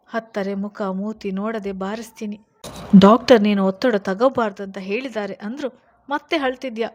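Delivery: loud, sharp scolding; sudden scolding. A narrator's reading. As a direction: snap at her to stop crying. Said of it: "ಗದರಿದನು ಒಮ್ಮೆಲೆ".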